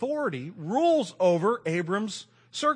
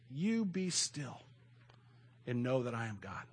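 soft, very slow and reverent; a hushed, drawn-out verse; hushed scripture reading; 4 semitones lower, 8 dB quieter than usual. Acted. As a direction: let each word settle quietly.

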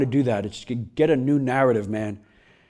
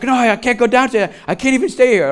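higher-pitched mock panic; imitated flipping out; 7 semitones higher than usual. Wife refusing to go down to the spider, flipping out. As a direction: refuse in an acted fright, pitched up. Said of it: "she's flipping out".

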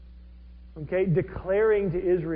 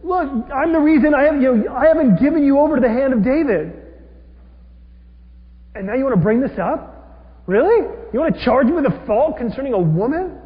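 loud, much higher, heated; furious rebuke; 6 semitones higher, 6 dB louder than usual. Retold or imitated. Abner turning the blame on Ishbosheth, loud and furious. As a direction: throw the charge back in his face. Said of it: "and then he says".

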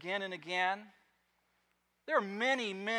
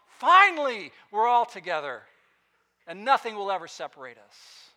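satisfied Father; 3 semitones higher, 12 dB louder than usual. Acted. Pastor voices God the Father's joy at the end of the story, loud and satisfied. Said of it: "hear the sigh of satisfaction".

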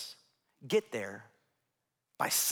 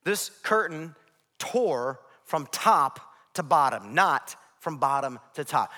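ordinary talk, nothing marked

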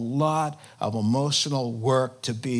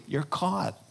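ordinary talk, nothing marked

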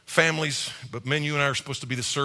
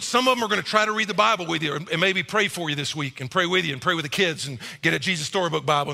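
normal talking voice

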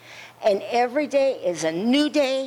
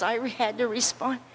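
usual speaking voice

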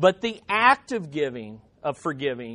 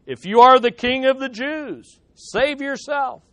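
raised pitch, mocking challenge; 5 semitones higher, 4 dB louder than usual. Roman soldiers taunting Jesus with sour wine, mocking. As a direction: read it as a taunt, higher and firmer. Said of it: "they also mocked him".

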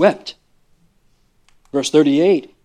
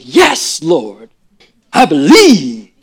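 excited; 7 dB louder, much slower than usual. Shown as a demonstration. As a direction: say it as an eager yes. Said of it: "did she say it that way?".

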